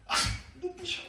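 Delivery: sudden high yelp at bright light; dazzled cry. Acted. Blind man opening eyes outside, high startled cry. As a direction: flinch and squeal at the glare. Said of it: "이렇게 했을 거예요".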